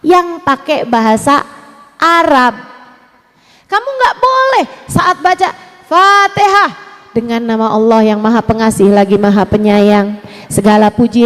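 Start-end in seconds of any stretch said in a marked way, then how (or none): 3.7-6.7 emphatic warning. Real, not acted. Preacher forbidding emphatically.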